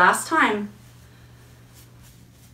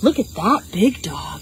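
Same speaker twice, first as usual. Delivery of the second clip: ordinary talk, nothing marked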